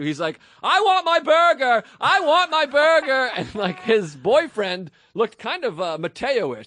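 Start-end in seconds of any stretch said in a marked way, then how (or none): 0.6-3.3 high, loud, slow repeated yell; retold tantrum. Customer losing it over his burger, slow shrill yell. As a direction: wail the demand like a toddler.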